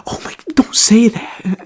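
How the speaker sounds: put-on whispered, scandalized parent hushing the child; hushed parent. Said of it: "his parents are like".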